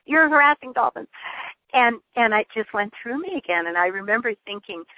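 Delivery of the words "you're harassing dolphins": retold scream. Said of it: "screaming right at me".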